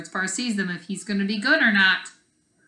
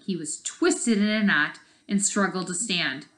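usual speaking voice

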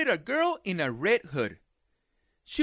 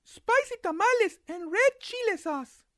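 put-on high girl's voice; little girl voice.

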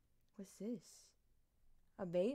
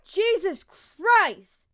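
startled exclamation; 9 semitones higher, 4 dB louder, much slower than usual. Real, not acted. Startled by something onscreen.

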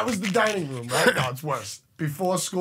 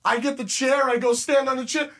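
ordinary talk, nothing marked

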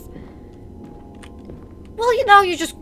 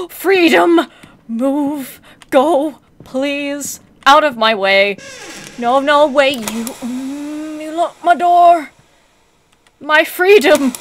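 frantic escape yells; 3 semitones higher, 5 dB louder, slower than usual. Real, not acted. Fleeing past mannequins.

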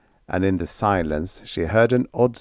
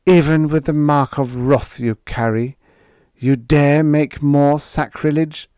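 angry; 6 semitones higher, 6 dB louder than usual. A narrator's reading. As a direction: scold him with righteous anger. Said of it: "Anger was in her voice".

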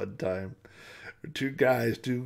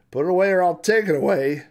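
loud, raised warning; firm threat; 8 semitones higher, 11 dB louder than usual. Acted. Sheriff orders a man to put the rifle away, loud warning. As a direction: lay down the law loudly.

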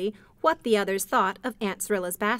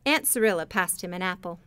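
ordinary delivery

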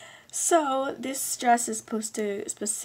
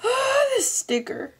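excited gasp; 4 dB louder, slower than usual. Real, not acted. Unboxing gifts, gasps at sticker.

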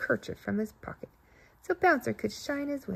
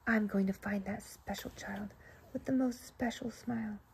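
inner thought; 8 dB quieter than usual. Acted. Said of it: "Bouncer thought to himself".

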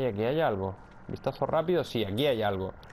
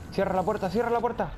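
urgent; 8 semitones higher, faster than usual. Real, not acted. Urgent shout to shut the door.

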